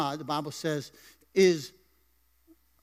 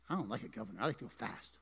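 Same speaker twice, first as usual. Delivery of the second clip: much softer, lower, muttered confession; quiet aside; 8 semitones lower, 12 dB quieter, faster than usual. Real, not acted. Pastor confessing to congregation in a low murmur.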